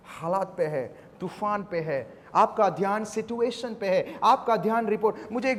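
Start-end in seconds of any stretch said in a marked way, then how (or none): none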